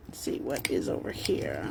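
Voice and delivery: breathy near-whisper aside; whispering to self. Real, not acted. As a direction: murmur it under the breath. Muttering to self while searching.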